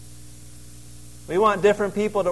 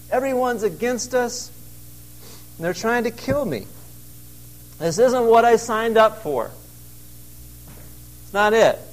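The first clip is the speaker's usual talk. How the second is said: higher, complaining prophet; whiny Elijah; 5 semitones higher than usual. Retold or imitated. Preacher voicing Elijah complaining to God, higher and whiny. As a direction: whine like a put-upon prophet.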